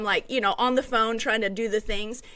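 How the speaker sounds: ordinary talk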